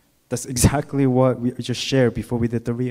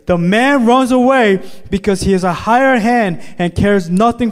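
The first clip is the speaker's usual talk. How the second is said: higher, louder proclamation; full-force preaching; 10 semitones higher, 8 dB louder than usual. Acted. Pastor proclaiming Jesus as the good shepherd, preaching hard. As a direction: proclaim it with conviction.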